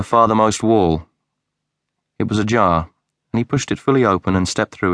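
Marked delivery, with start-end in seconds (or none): none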